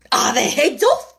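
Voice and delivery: loud, demanding call; shouted. A narrator's reading. The Queen checks on the execution, shouting. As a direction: shout the question imperiously. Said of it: "shouted the Queen".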